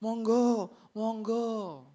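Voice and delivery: drawn-out courteous Javanese invitation; fake politeness. Person giving way in front of others, falsely polite. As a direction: imitate someone over-politely giving way. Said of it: "kita suka berpura-pura".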